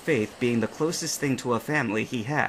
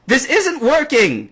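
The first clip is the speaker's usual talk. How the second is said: slow, much higher, louder frustrated yell; 14 semitones higher, 10 dB louder, slower than usual. A narrator's reading. James soaked after a kick, frustrated yell. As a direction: wail it in defeat.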